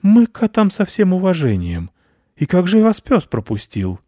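high, loud squeaky bat voice; fawning little bat, high-pitched; high bat voice flattering the cats; placating bat. A narrator's reading. Head bat voice.

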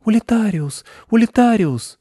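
put-on high, piping child's spell; little girl's incantation. A narrator's reading. Anechka waving her fairy wand at trees, childish incantation. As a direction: repeat the spell like a small girl. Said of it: "и бормотала".

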